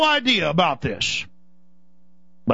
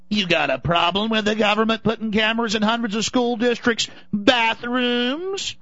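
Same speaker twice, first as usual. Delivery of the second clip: higher, taunting official daring you to object; mocking government voice; 6 semitones higher than usual. Government taunting the public, sarcastic and much higher. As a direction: sneer each question like a smug bureaucrat.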